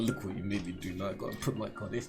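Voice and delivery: imitating mom scolding. Son imitating his mom after a smack, low and soft. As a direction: grumble it under your breath like a tired mom. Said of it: "chat my mom would say".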